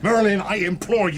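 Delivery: desperate plea. Acted. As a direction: beg him urgently. Lord begs wizard.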